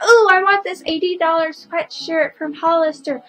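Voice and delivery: a hint of a spoiled kid asking for things; bratty kid voice. Vlogger mimics a spoiled kid wanting a sweatshirt, light whine. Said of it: "a kid will be like".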